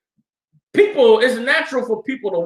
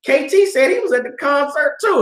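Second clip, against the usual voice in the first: teasing classmate; 7 semitones higher than usual. Retold or imitated. Classmate calls him out.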